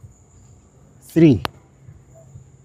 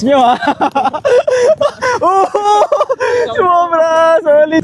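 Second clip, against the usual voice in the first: excited laughing praise; 12 semitones higher, slower than usual. Real, not acted. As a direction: cheer with a burst of laughter. Excited praise after push-ups.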